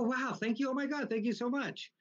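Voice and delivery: high-pitched mock-grateful gush; gushing thanks. Retold thanks for a gift.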